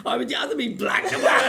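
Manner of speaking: hammed-up shouted line through laughter; laughing mock shout. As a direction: bellow it as a cheeky comeback. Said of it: "And he was like".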